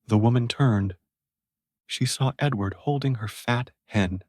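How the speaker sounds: ordinary delivery, nothing marked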